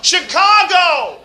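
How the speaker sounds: high, slow shouted "Chicago!"; shouting back. Pretend. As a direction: yell it back, stretched out. Shouted answer from afar.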